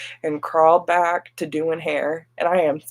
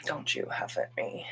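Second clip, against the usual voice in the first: soft whispered scold; hush-scold a sleeping pet; whispering; 7 semitones lower, 16 dB quieter than usual. Real, not acted.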